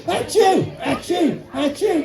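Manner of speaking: high, hammed-up sneezes; fake sneezes. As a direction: play the sneezing Santa. Santa stuck in chimney.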